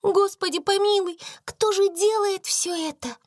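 high, astonished cry; amazed exclaim. Acted. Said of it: "воскликнула Бекки".

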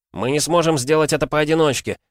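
high, loud joyful cry; excited shout. A narrator's reading. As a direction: burst out with sudden joy. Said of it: "обрадовался Игрорыцарь".

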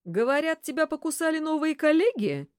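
mock her slyly; snide. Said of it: "ехидно спросил Кудесников".

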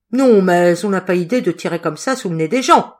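loud, indignant scolding; angry. A narrator's reading. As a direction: tell him off crossly. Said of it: "fit Madeleine en colère".

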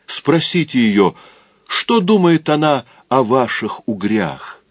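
mocking taunt. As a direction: jeer at each one. Taunting people in the bar.

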